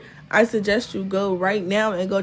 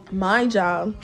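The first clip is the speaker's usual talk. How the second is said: usual speaking voice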